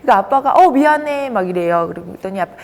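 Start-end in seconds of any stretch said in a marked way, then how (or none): 0.5-1.3 high, loud apology, lightly acted; 사과하는 아빠. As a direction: blurt a quick dad's sorry. 아빠가 아이에게 사과하는 장면. (높고 큰 소리로 사과하는 아빠 흉내)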